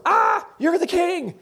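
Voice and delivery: high, slow, loud cry of shock; startled witch. Acted. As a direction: gasp it out in terror at the risen prophet. Witch sees Samuel rise.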